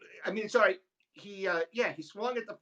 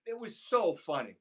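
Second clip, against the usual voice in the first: normal talking voice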